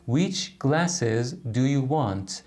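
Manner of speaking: Which glasses do you want read very slowly, each word clear; slow model reading. Shown as a demonstration. Teacher slowly models the question Which glasses do you want. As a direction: spell it out slowly for learners. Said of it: "teacher models the question slowly".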